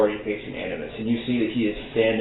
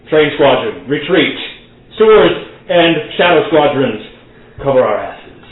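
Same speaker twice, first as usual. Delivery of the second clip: loud barked orders to troops; commanding shout; 4 semitones higher, 7 dB louder than usual. Acted. Animus ordering his squadrons to retreat, loud commands. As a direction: call the retreat across the field.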